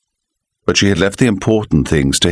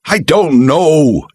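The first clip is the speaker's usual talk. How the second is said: slow, louder, drawn-out outcry; cried out; 9 semitones higher, 4 dB louder, much slower than usual. A narrator's reading. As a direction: cry it out in distress. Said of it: "he cried".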